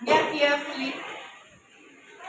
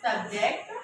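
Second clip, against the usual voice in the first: ordinary delivery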